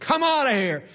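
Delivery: high, loud retold command; booming command to the devil; loud rebuke. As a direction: shout it out like the praying father. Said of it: "He says".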